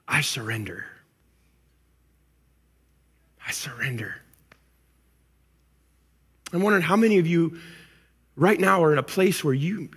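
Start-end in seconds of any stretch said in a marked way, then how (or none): 0.1-4.2 low and very soft, fading to a whisper; soft, defeated prayer sinking into breath; hushed surrender. Acted. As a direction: give up quietly, almost voiceless. Acting surrender to God.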